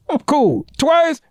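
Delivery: ordinary talk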